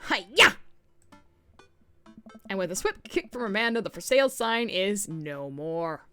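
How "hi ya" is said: loud martial arts yell; karate shout. Acted. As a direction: kick it with a yell. Karate kick on the sign.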